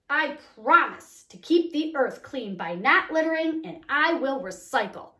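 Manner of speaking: loud, slow, excited read-aloud with a bang at the end. Shown as a demonstration. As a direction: read it out like the exclamation point is shouting for joy. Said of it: "I'm gonna read this a little differently".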